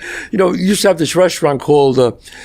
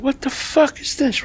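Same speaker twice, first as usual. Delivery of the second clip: put-on shocked kid; 11 semitones higher, 6 dB quieter, slower than usual. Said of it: "I said".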